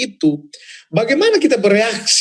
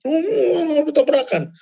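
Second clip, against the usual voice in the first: mock surprise; 9 semitones higher, much slower than usual. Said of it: "tersebar di mana-mana".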